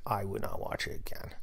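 hushed, breathy aside to himself; whispered aside. Real, not acted. Podcaster muttering his verdict to listeners in a whisper. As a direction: drop to a whisper to confirm it.

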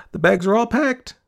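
put-on high, loud girlish announcement; announcing girl. A narrator's reading. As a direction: announce it proudly in a high child's voice. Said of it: "she announced upon her entry".

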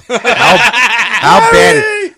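high, loud, laughing through the words; cracking up mid-question; laughing disbelief. Real, not acted. Laughing at a friend's movie pick, cracking up.

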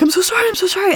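high, urgent mock apology; panicked child. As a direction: blurt it like a six-year-old in a panic. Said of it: "just start to panic".

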